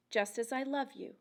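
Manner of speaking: ordinary delivery, nothing marked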